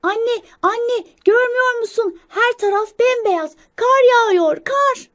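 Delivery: put-on very high, loud child squirrel; excited calling out. Young squirrel showing mother the snow, high excited shouting. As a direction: call out in a thrilled child's voice. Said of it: "annesine sesleniyor".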